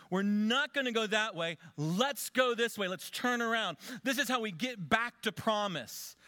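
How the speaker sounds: rally the congregation to turn around; fervent preaching. Acted. Preacher urging the church to repent, fervent.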